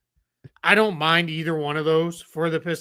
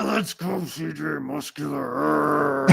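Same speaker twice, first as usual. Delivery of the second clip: drawn-out announcer call; slow ring announcer voice; wrestling promo; slower than usual. Acted. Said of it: "It's like a wrestling promo".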